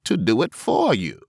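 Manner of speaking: high, loud goblin voice; cheeky creature asking a price; hobgoblin voice. Acted. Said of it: "said the hobgoblin".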